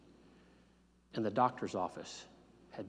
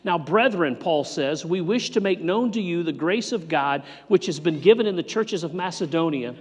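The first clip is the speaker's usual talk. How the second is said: louder, higher preaching voice; preaching at full force; 7 semitones higher, 9 dB louder than usual. Acted. Pastor urging the congregation to give, full-force preaching. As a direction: drive the point home from the pulpit.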